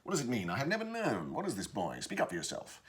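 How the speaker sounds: put-on baffled, demanding old father; curmudgeonly father, not overdone; mildly blustering father. Shown as a demonstration. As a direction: bluster a little, pitch leaping on the questions. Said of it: "not quite the blustering sort of character".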